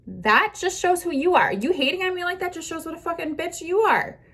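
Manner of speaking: normal talking voice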